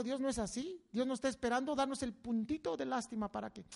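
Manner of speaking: ordinary delivery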